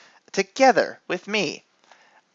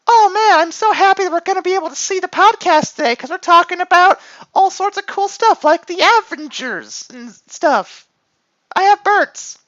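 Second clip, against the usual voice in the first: put-on much higher, fast bubbly anime girl; 3 dB louder than usual. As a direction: gush like a cheerful anime girl. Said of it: "anime girl voice".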